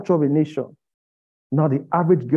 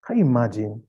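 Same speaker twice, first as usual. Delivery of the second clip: usual speaking voice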